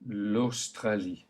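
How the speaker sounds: L'Australie slowly, au as a clear o; slow French AU. Shown as a demonstration. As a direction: model it slowly. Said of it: "Try it".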